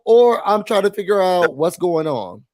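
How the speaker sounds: normal talking voice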